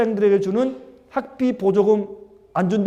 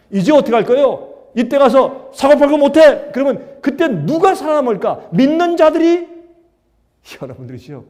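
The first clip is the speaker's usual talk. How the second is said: louder, higher fervent preaching; preaching at full force; 6 semitones higher, 8 dB louder than usual. Acted. Preacher warning the congregation about the coming crisis, fervent and loud. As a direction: build it like a pulpit warning. Sermon climax, rising fervour.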